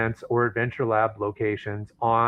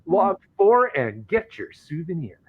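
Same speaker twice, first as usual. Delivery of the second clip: hammed-up sales pitch; 5 semitones higher than usual. Host hawks a geocache extravaganza to listeners, hammed-up sales pitch.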